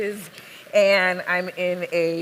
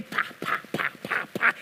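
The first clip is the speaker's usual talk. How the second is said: sharp voiceless "pa" beats; percussive, unpitched mouth knocks; imitated knocking; faster than usual. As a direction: knock it out with repeated popped syllables.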